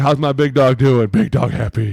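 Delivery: loud, hearty praise for the big dog; mock coach bellowing praise; booming coach praise. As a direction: pump up your player like a proud coach. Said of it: "be like".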